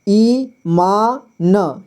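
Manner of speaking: "ईमान" broken into slow ई, मा, न; very slow "ईमान", final न voiced on its own; sounded out. Shown as a demonstration. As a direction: sound out "ईमान" piece by piece with long pauses. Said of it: "ईमानदार क्या है".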